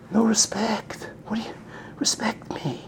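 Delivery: breathy hurt whisper of a slighted parent; whispered no respect; whispered complaint. Acted. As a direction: mutter it to yourself, wounded. Kid voicing hurt complaint.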